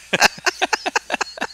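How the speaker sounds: high, light burst of laughter; real laugh. Real, not acted. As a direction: laugh along at the booth banter. Booth joking about food orders.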